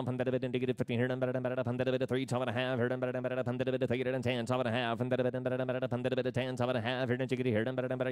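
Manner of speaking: rapid rolling auction chant; auctioneer. Acted. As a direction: rattle off bids in a fast singsong. Livestock auction bid calling.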